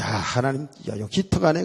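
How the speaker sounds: ordinary talk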